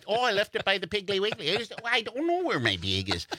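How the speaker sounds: high, fast Wisconsin fluster; "bag" said like "big", frantic; Wisconsin accent. Retold or imitated. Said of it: "We say bag".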